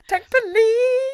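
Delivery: high, loud, slow title shout; excited shout. Pretend. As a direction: belt out the title.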